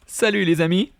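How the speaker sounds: Salut les amis with a high, rising Salut. Shown as a demonstration. Teacher showing the greeting with a lifted pitch. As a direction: lift Salut up high like a cheerful hello. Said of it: "Je vais faire aussi".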